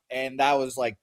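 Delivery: ordinary delivery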